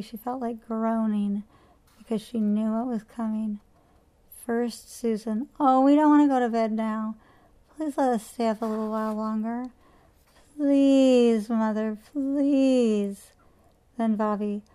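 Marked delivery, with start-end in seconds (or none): 5.6-13.3 put-on loud, pleading child's whine; whining child. A narrator's reading. Children whining to their mother to stay up late. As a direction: beg and whine like a kid at bedtime. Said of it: "whining and complaining".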